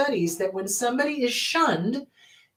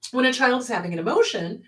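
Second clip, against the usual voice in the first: normal talking voice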